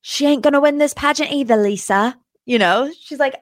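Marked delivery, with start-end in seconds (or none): 0.0-2.2 James Kennedy line, retry. Retold or imitated. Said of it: "Let me try it again".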